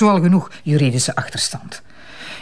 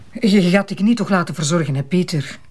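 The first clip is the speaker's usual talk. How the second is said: ordinary talk, nothing marked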